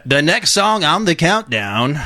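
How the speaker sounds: Casey Kasem. Acted. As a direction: announce it like a radio chart host. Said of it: "wish I could do a Casey Kasem voice".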